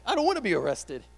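high, loud comic pleading; mock panic. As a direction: protest in exaggerated alarm. Acts out fear of arrest.

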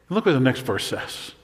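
normal talking voice

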